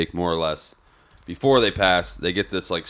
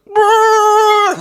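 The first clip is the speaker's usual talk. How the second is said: shrieked bruh, much higher and louder; incredulous yelp; 10 dB louder than usual. Retold or imitated. Retelling the nurse scene at grandma's death, a shocked high yelp. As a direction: let out a stunned, disbelieving shriek. Reacting to nurse offering water.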